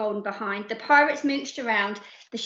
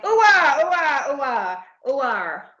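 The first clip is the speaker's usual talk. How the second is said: pirate cheer; 3 semitones higher, 5 dB louder, slower than usual. Acted. Singing the baby's toe rhyme, a loud chant fading away. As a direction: start with a big cheer, then let it die down. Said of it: "this little pirate went".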